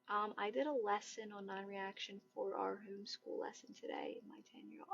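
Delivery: very soft, trailing into whisper; reading under breath. Real, not acted. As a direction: read the comment quietly to yourself. Reads a viewer's comment before replying.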